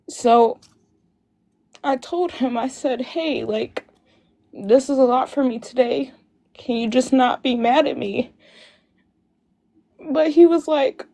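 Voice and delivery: fighting tears while telling it; tearful. Real, not acted. Telling the host about her sister's death date, tearful. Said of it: "trying not to get emotional".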